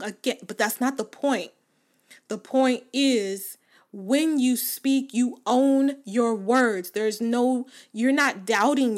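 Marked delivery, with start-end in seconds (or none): 2.3-6.9 slow. Shown as a demonstration. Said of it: "slow deliberate delivery".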